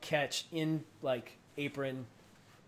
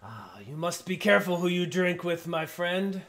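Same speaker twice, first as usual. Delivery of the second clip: giant gloating; 2 semitones higher, 6 dB louder than usual. Acted. Giant pocketing the gold, gloating warning. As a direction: chuckle then louder warning. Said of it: "he goes".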